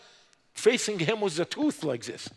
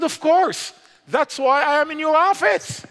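bold preaching; 10 semitones higher, 5 dB louder than usual. Retold or imitated. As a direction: preach it triumphantly to the official. Bold answer to interrogator.

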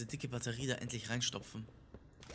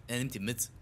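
normal talking voice

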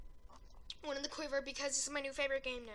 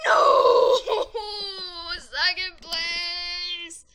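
anguished shout; 4 semitones higher, 18 dB louder, much slower than usual. Real, not acted. Just missed first place.

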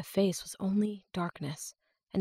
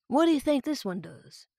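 put-on rural accent; 6 semitones higher than usual. A narrator's reading. Captor voiced, rural accent.

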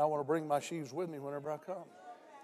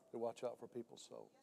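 "They watch out for people's souls" dropped much softer, like an echo; 5 semitones lower, 12 dB quieter than usual. Acted. Preacher repeats the point to the congregation, much softer. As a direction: let the repeat fall quiet and inward. Repeated line, second take.

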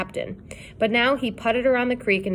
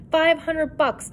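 amazed; 8 semitones higher, slower than usual. Acted. Boy amazed at reward.

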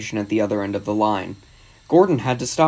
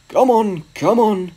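high, louder, punchy calls; puffed urging; 9 semitones higher, 5 dB louder, slower than usual. A narrator's reading. Gordon puffing to the coaches, urgent. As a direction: urge them along in bursts. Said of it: "he puffed to the coaches".

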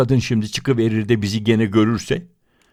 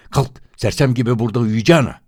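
rough order; 4 semitones higher, 6 dB louder, faster than usual. Acted.

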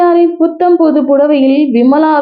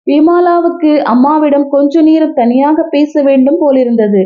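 ordinary delivery

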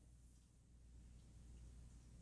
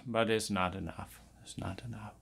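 fading mock lament; 4 semitones lower, 16 dB quieter than usual.

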